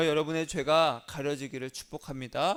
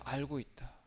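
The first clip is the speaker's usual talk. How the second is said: softer, lower gentle voice of Jesus; Jesus answering; 3 semitones lower, 10 dB quieter than usual. Pretend. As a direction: answer like a calm, forgiving Lord, hushed. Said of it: "예수님께서 뭐라고 하셨냐".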